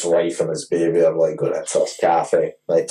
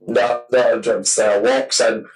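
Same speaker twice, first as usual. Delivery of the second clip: ordinary talk, nothing marked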